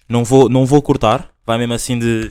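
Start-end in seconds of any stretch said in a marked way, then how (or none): none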